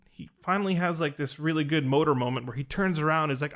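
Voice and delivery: usual speaking voice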